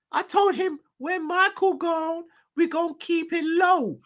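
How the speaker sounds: high squeaky put-on owner voice, hammed up; J W Henry impression. Owner ranting about spending all his money, in a squeaky high mock voice. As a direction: whine about your money in a silly high voice. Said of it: "his J W Henry impression".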